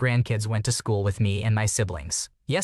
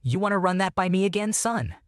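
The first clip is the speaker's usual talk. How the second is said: higher-pitched quoted challenge; lightly voiced challenger, pitched up; veteran's challenge; 7 semitones higher than usual. A narrator's reading. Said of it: "He said".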